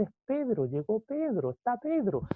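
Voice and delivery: lightly acted, high breaking-news cry; excited announcement. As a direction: imitate a girl bringing thrilling news. Acted girl announcing Peter is there.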